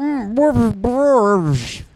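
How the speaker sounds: high, slow garbled British blustering; Nigel Thornberry. Acted. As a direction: slur the words as a cartoon Brit. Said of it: "British Thornberry accent".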